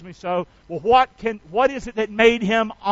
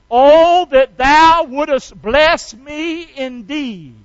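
loud, drawn-out plea, pitched high; big, stretched-out preacher's rendering of a prayer; praying aloud; 5 semitones higher, 10 dB louder, much slower than usual. Acted. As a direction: cry out the prayer slowly and loudly. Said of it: "Then he says this".

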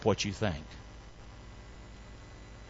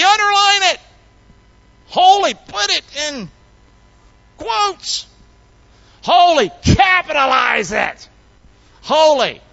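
"Holy" cried loud and high; escalating emphasis; 11 semitones higher, 7 dB louder than usual. Shown as a demonstration. Preacher showing how we emphasize in writing, "Holy" belted out. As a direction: hit each "Holy" harder, as if marking it on paper. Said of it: "Here's how we do it".

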